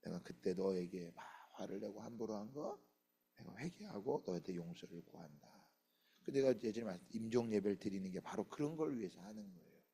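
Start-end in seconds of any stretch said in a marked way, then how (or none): 0.0-5.7 soft, low voice of an apologizing parent; quiet apology. Acted. Parent asking the children's forgiveness after anger, quiet apology. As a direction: say sorry quietly, pitched down.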